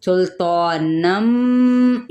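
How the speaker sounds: सुल्तानम said very slowly with the final म held nasal; nasal merge. Shown as a demonstration. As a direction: hum the ghunna in सुल्तानम. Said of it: "गुन्ने के साथ proper".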